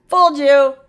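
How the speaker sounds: spring the trick on them; playful gotcha. Acted. Teacher teasing her class, playful gotcha.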